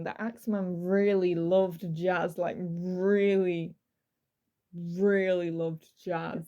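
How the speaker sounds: really, really loved jazz drawn out slow; heavy emphasis. Acted. Host stressing the killer's love of jazz, slow emphasis. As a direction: lean hard on really, slow and deliberate. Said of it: "Emphasizing that a lot".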